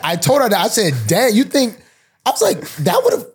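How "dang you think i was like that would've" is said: mock disbelief. Reacting to girlfriend's suspicion.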